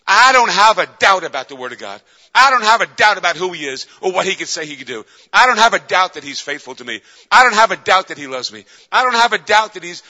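loud, high-pitched declarations of faith; full-force preaching. Acted. Preacher declaring to the congregation he has no doubt, forceful. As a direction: hammer each declaration home from the pulpit.

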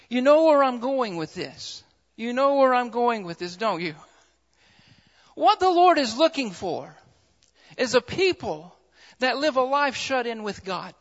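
ordinary delivery

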